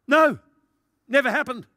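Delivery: loud, high-pitched mock denial; acted total denial. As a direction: deny it loudly and high, playing the guilty party. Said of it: "Absolute total denial of any guilt".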